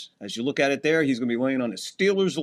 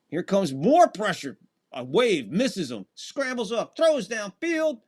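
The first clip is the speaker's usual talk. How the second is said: climbing much higher, drawn out on the throw; excited play-by-play; 5 semitones higher, slower than usual. Real, not acted. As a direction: call the scramble as it unfolds, building. Live play-by-play of scramble.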